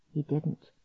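plain reading voice

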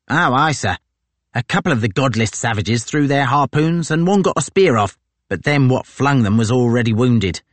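a gloating sergeant pleased with himself; brash marine sergeant. Acted. Tozer to his captain, brash and gloating.

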